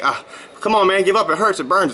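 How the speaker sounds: mock protest from a tired body; body begging to quit. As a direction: plead to give up mid-workout, half in play.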